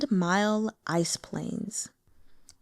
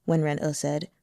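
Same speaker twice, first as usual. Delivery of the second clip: ordinary delivery, nothing marked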